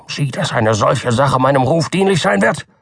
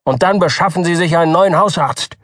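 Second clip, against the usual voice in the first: raised, fast indignant tirade; cutting, angry doctor; 4 semitones higher than usual. A narrator's reading. Doktor Keene angrily breaks with the Button family. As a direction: dismiss him with scorn. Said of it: "antwortete der Doktor schneidend".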